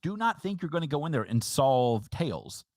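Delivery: ordinary delivery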